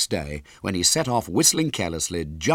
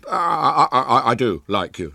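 put-on louder, stammering boy; desperate; 6 semitones higher, 6 dB louder than usual. A narrator's reading. Boy placates a crying girl, stammering desperately. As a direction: stammer out a frantic reassurance. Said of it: "said William desperately".

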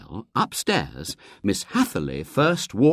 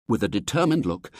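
usual speaking voice

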